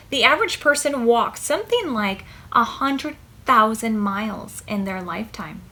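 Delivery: usual speaking voice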